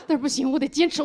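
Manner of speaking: normal talking voice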